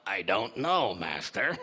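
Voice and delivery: Igor. Acted. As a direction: mumble it as the hunched servant. Igor answers.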